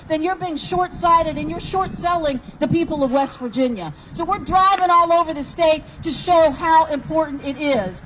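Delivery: ordinary talk, nothing marked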